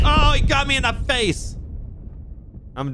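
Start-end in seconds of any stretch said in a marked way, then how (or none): 0.0-1.5 surprised yelp. Real, not acted. Player shot in game.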